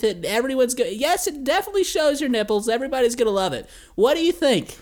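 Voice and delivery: high, eager stylist voice pitching an outfit; excited handler pitch. Retold or imitated. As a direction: sell it like a giddy stylist.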